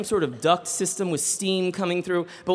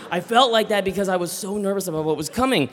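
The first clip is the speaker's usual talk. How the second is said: usual speaking voice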